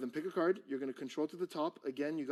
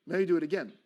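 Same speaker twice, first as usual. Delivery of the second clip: loud, higher-pitched command; exasperated order; 4 semitones higher, 11 dB louder, faster than usual. Shown as a demonstration. Magician orders his assistant to retry, exasperated. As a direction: order a failing helper to retry, loudly. Acted scolding of the stick man.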